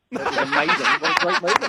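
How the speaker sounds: loud, high, swooning praise with a laugh; laughing, hammed-up rapture; mock gushing. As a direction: play a man overcome by a weepy film. Hormone patch made him emotional.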